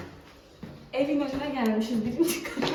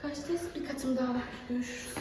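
normal talking voice

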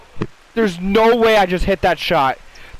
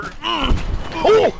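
pterodactyl shriek; 9 semitones higher than usual. Acted. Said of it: "I can sound like a pterodactyl".

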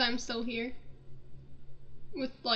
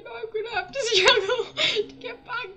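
laugh-crying; 6 semitones higher, 6 dB louder than usual. Real, not acted. Frustrated player laughing at her bad luck. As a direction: dissolve into laughter.

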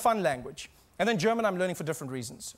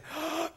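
high, soft acted gasp; shocked gasp; 10 semitones higher, 5 dB quieter than usual. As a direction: gasp as the stunned shop woman. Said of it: "looked at me and she said".

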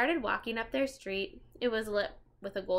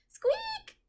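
tiny squeak way up high; high mouse squeak; 4 dB louder than usual. Acted. Peanut the mouse answering the children, a high squeak.

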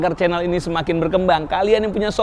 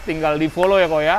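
muffled behind a mask; talking through a face mask; 4 semitones lower than usual. Real, not acted. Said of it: "kita bikin video pakai masker".

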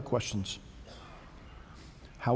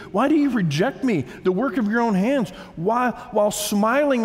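higher-pitched, impassioned protest to God; anguished pleading; 7 semitones higher than usual. Acted. Job demanding answers from God, anguished pleading. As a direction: plead your case, pitch swinging high.

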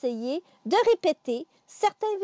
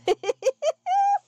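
laugh; 3 dB louder than usual. Real, not acted. Amused after tongue twister.

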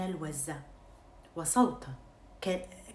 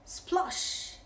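splash sound; 10 semitones higher than usual. Acted. Thieves fall in pond.

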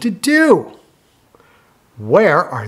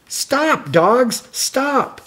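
much higher stop call; 9 semitones higher, slower than usual. Acted. Calling stop to the dogs in the book. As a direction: sing out to halt them.

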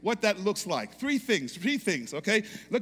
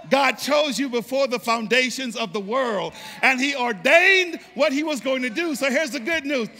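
building to a loud, high, sung-out climax; preaching at full force; 5 semitones higher, 6 dB louder than usual. Acted. Pastor whips the congregation into a climax, loud and soaring. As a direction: preach it up until it rings.

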